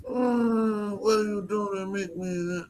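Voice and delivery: high-pitched mock Italian mobster voice; Godfather. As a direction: put on a drawn-out, high mafia-boss impression. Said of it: "talk like the Godfather".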